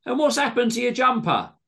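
high, loud accusing voice; indignant question. A narrator's reading. Greg asking about John's ruined jumper, indignant. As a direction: keep challenging him, outraged.

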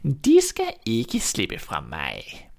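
very slow, sinister witch voice; wicked witch. A narrator's reading. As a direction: savour the threat like a gloating witch. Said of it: "grinede hun ondskabsfuldt og sagde". (ondskabsfuld, langsom heksestemme)

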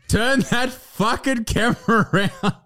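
slow command through laughter; laughing demand. Real, not acted. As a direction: order it while cracking up.

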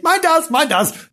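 high, eager little outburst; excited boast. Pretend. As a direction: pipe up proudly and high. Excitedly answers own tail question.

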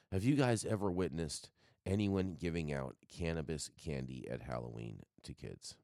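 ordinary talk

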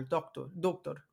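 doctor, doctor, said slowly and softly as "toktor"; slow careful doctor, doctor; Russian word. Shown as a demonstration. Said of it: "sounds like doctor".

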